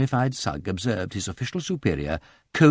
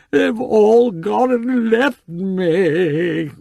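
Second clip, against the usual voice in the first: much higher, loud, very slow blubbering; drunken tears; 13 semitones higher, 7 dB louder, much slower than usual. Acted. Freddy wails to Sugg, high, slow and tearful. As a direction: wail like a weepy drunk. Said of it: "bursting into tears".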